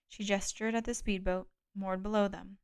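ordinary delivery

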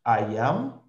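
teacher modelling words slowly for repetition; slow, clear drill for students to echo; slow drill model. Shown as a demonstration. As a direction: say each form clearly, pausing for the class.

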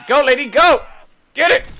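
high, loud cheer; root for her loudly. Real, not acted. Cheering on the character.

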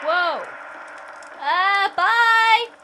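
loud, high panicked farewell; startled yelp. Real, not acted. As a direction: yelp it and run. Fleeing something scary.

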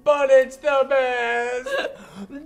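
high, loud slow laughing; can barely speak for laughing. Real, not acted. Laughing at accent.

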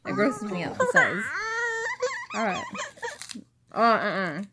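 high, loud disgusted whine; grossed out. Real, not acted. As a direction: squeal at the gross texture. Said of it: "That's so gross".

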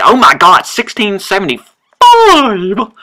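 loud, high, stretched cry of disbelief; exaggerated shock. Player sees the game year and hollers in high, drawn-out shock. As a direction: build to a long hollered last word.

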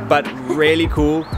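usual speaking voice

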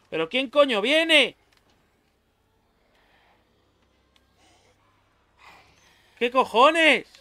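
high, loud alarmed outburst; panicking as the zombie comes. Real, not acted. Enemy appears in game. (alarmado, agudo y fuerte)

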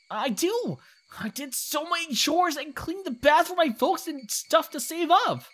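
put-on high, squeaky boy bragging; eager kid. Kid telling shopkeeper about saving up from chores, eager. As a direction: insist high and excitedly like a little kid.

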